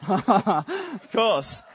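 laughing through the words. Real, not acted. Pastor laughing at Garth's Bible case, laughing. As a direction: giggle it out.

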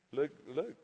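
usual speaking voice

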